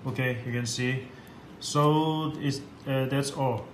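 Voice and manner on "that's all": ordinary talk, nothing marked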